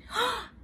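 high storybook gasp; acted gasp. Niece hears uncle's complaint, high acted gasp. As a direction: draw in a sharp breath.

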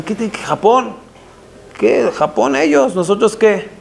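high-pitched incredulous questions; acted bafflement. Disciples questioning their rabbi about Japan, baffled. Acting the disciples' puzzled objection.

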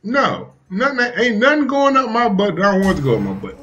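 high-pitched, protesting husband; indignant denial. Acted. Husband snapping back at his wife, high and indignant. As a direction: shoot it down in a high, offended voice. Acted husband refusing wife's warning.

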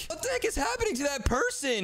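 high-pitched disbelief; alarmed astonishment. Pretend. Seeing a player turn monster, high startled alarm.

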